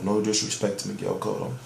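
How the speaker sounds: sarcastic quiet repeat of the disclaimer; muttered aside. Real, not acted. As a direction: drop it to a throwaway mumble. Repeats disclaimer, second one muttered.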